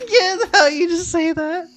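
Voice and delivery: high voice breaking into laughter; laughing. Real, not acted. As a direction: squeal it through laughter. Laughing at a friend's remark.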